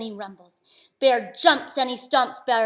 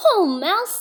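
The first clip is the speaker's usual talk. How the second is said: a higher, brighter-pitched friendly greeting; hare voice; 8 semitones higher, 7 dB louder than usual. Acted. Hare greeting Mouse, lightly voiced.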